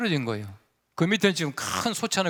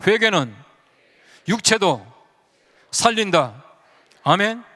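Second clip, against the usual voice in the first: louder, rousing pulpit call; full-force preaching; 4 dB louder than usual. Acted. Preacher calls the congregation to repeat after him, loud and rousing. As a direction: drive it home to the congregation.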